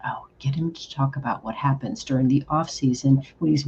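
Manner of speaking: whispered aside, as if sharing a secret. Retold or imitated. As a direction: breathe it like a tip passed in secret. Quoting Missus Claus's suggestion.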